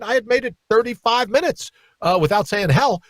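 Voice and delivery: ordinary delivery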